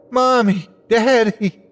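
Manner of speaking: high tearful calling; tearful joyful high calling; joyful cry. A narrator's reading. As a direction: cry out to them with joy. Calling to parents, crying.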